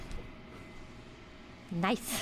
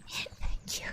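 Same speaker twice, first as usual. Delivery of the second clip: unvoiced whispered thanks; whispering. Real, not acted. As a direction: breathe the thanks.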